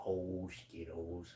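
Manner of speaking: normal talking voice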